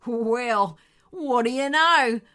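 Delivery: drawn-out, sneering amusement; mock their claim slowly, on the edge of laughter; chuckling dingo. A narrator's reading. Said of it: "chuckled the lead dingo".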